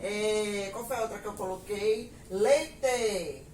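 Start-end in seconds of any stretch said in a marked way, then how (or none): 2.3-3.4 Paraná-style "Leite" with a full final "ê"; Paraná accent, clear closed E ending "Leite". Retold or imitated. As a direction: imitate Paraná, saying Leite as "leitê", louder. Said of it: "eles pronunciam as palavras com som de E".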